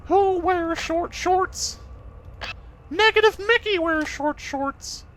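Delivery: high, slow cartoon mouse voice; squeaky toon mouse, drawn out slowly; goofy cartoon voice. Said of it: "That's a really bad, goofy impression".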